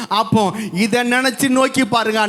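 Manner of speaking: ordinary delivery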